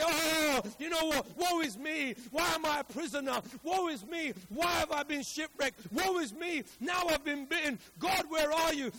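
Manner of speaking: loud, high woe-is-me complaint; preacher's hammed-up wail of despair; mock crying lament. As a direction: cry out in exaggerated self-pity. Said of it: "He doesn't roll over and cry and say".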